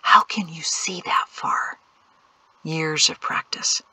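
slow whispered voice; hushed exchange. A narrator's reading. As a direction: whisper slowly, keeping out of earshot. Hushed exchange while watching riders.

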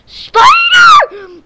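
shrill, very high, loud shriek; panicked scream of fear; terrified scream. Real, not acted. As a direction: scream in real terror. Spider sound at night.

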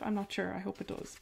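ordinary talk

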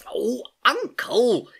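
high, loud, drawn-out reproach; angry exclamation. A narrator's reading. Soaked niece scolding her uncle, angry. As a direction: cry it out, cross and scolding. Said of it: "exclaimed Gedanken angrily".

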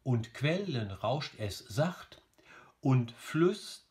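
slow beats on "QUELlen RAUSCHT es SACHT"; metrical scansion. Shown as a demonstration. Teacher testing the line, slow scanning. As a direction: split "flüsterte" into slow separate syllables, beating the metre. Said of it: "alles noch in Ordnung".

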